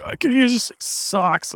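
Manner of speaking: mock complaint. Justin quoted complaining about Asana.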